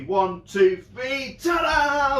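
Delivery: building count to a high, loud shouted ta-da. Shown as a demonstration. Magician counting viewers in, ending in a shouted ta-da. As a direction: count them in and explode on ta-da. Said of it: "on the count of three".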